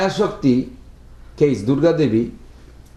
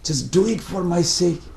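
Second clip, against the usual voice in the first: pleading father; 4 semitones higher, 3 dB quieter than usual. Acted. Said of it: "he was like".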